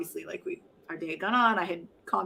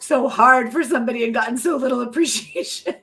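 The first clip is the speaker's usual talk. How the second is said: retold cry; 5 semitones higher, 4 dB louder than usual. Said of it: "I cried to my husband".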